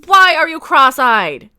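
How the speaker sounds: exasperated shout, drawn out; loud, slow, incredulous demand; yelling. Real, not acted. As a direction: yell at the cartoon, stretching the words. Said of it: "Sorry, I'm yelling".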